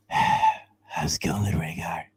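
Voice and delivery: slow, low ASMR whisper into the mic. Pretend. Friend greeting a listener in a slow low ASMR whisper. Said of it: "please make it stop".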